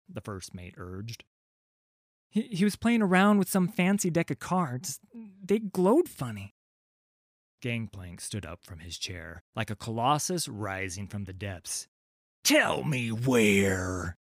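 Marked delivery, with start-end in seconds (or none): 2.3-6.5 put-on high, nervous boy voice; a scared lad blurting his news; frightened urchin. Urchin reporting to the pirate captain, high and nervous. 12.4-14.2 slow, cold, menacing captain; slow menacing demand. Acted. Captain demanding the location, slow and menacing.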